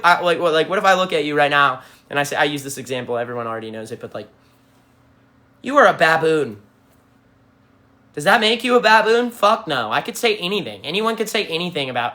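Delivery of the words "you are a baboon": a put-on voice, a touch louder; mock insult. Streamer insulting a viewer as an example, playful mock jab.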